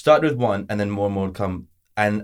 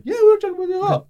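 high, quick mock fanboy voice; excited fan friends; faster than usual. Friends gushing about Dragon Ball Z, high mock-excited voice. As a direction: imitate keen friends in a squeaky rush. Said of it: "they started talking about Dragon Ball Z".

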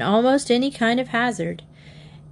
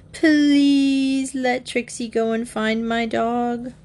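drawn-out, higher-pitched whiny plea; pleading; 5 semitones higher, slower than usual. A narrator's reading. Bobby begging to have his dog found, slow pleading. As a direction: beg slowly, stretching out the please.

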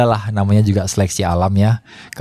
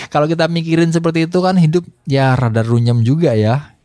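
chuckling through the words, higher pitch; laughing; 9 semitones higher than usual. Real, not acted. Speaker reflecting on life's muddle, amused and laughing. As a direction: let a real laugh spill into the line. Laughs over life being messy.

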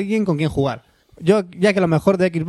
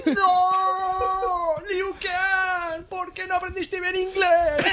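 high hammed-up wail, softer; mock anguished cry; 12 semitones higher, 4 dB quieter, much slower than usual. Crying out to Liu Kang in mock despair. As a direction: pretend heartbreak over bad English.

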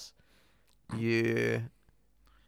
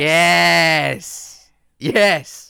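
loud, raised, delighted yes; excited cheer; 4 semitones higher, 12 dB louder than usual. Real, not acted. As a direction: cheer it in celebration. Cheers the album named by friend.

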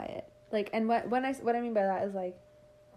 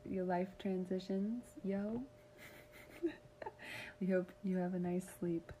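whisper; 8 dB quieter than usual. Real, not acted. Podcast sign-off whispered.

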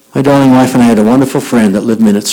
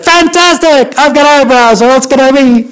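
very high, over-the-top delight; exuberant outburst; 12 semitones higher, slower than usual. Retold or imitated. Teacher answers student's greeting in the hall, exuberant outburst. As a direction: burst out ecstatically, way up in pitch.

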